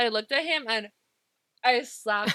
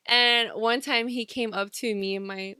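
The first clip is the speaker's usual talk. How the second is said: ordinary talk, nothing marked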